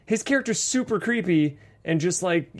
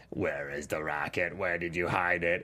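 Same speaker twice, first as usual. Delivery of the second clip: low, much softer hushed voice; creepy whisper; 9 semitones lower, 8 dB quieter than usual. Retold or imitated. Enforcer interrogating the injured man, creepy hush. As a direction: menace them in a quiet rasp. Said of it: "where he's like".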